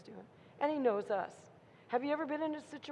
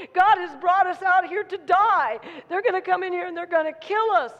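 loud, higher-pitched cry of doom; mock wailing panic; 6 semitones higher, 8 dB louder than usual. Israelites wailing as the armies approach, loud mock panic. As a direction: cry out in hammed-up despair. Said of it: "Now they're crying and weeping".

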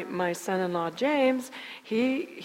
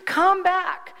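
slow, high, loud call across the cliff; hollering; 9 semitones higher, 8 dB louder, slower than usual. Retold or imitated. Mother calling Henry back up from the cliff, loud and slow. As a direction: shout it slowly down the hill to him. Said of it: "I hollered over".

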